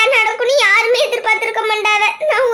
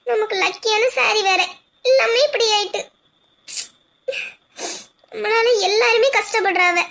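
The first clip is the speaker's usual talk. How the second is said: usual speaking voice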